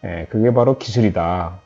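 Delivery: ordinary talk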